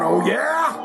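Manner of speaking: high mock challenge; a cocky taunt; defiant Gene. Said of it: "he's up there going".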